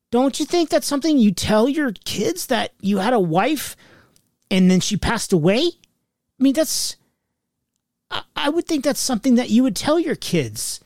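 retold reproach. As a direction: confront dad over what he never told. Retold confronting dad by phone.

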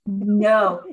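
normal talking voice

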